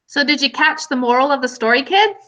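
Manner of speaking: usual speaking voice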